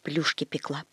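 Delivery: soft timid whisper; whispering girl. A narrator's reading. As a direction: whisper it, ashamed and teary. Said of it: "шептала девочка".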